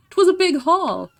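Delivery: mock sea-dog boast with a laugh; half-hearted old sailor. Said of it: "I can't do the accent".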